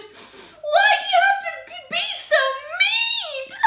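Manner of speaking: very high, tearful wail; upset shout. Acted. Spike is rejected by would-be friends, upset high shout. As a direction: cry out, wounded and protesting. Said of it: "He was so upset, he shouted".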